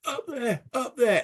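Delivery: high, urgent little call; voiced soldier. Soldier Davis directing Hibbert upward, high urgent voice. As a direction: point a comrade upward in a high voice. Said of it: "Davis is going".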